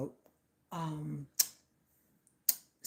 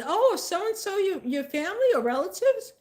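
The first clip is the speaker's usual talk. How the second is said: high, loud nosy exclamation; eager surprised inquiry; 12 semitones higher, 6 dB louder than usual. Retold or imitated. School admin doing intake asks the mom about kids, high eager surprise. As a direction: pipe up loudly, as if delighted by a coincidence.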